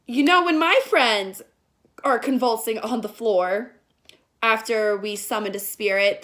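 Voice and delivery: ordinary talk, nothing marked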